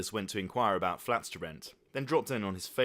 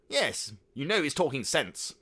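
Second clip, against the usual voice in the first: lightly raised, chiming voice; piping in; 6 semitones higher, 6 dB louder than usual. A narrator's reading. Uncle Michael chiming in with agreement. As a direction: chime in brightly. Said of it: "piped in Uncle Michael".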